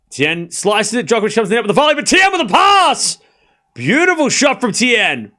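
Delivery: loud, high-pitched rally call, rising with each shot; excited play-by-play. Acted. Streamer calling the Djokovic rally live for chat, loud and excited. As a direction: call the rally like a courtside commentator.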